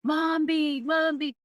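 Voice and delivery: put-on high, whiny child calling Momby. Son pinching his mother while sleepy, whining. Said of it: "he always likes to pinch".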